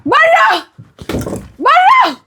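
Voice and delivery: high-pitched screaming order; hysterical yelling. Acted. Woman throwing her fiancé out, hysterical screaming. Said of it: "وايه الزعيق والصوت".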